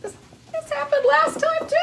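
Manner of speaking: high, loud exasperated squeal. Real, not acted. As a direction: squeal it out in disbelief. Struggling with jar, exasperated.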